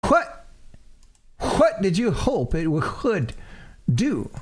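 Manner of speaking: high Southern lawyer voice, puzzled; a baffled Southern uncle. Acted. The uncle voice reacts.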